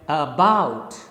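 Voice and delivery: about said with a light a and a strong BOUT; correct stress. Shown as a demonstration. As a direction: model about, stressing the second syllable. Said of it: "So you speak it like".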